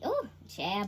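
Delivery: ordinary talk, nothing marked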